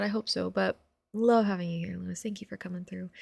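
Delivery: soft breathy whisper to a viewer. Real, not acted. Streamer whispering thanks to viewer Lewis.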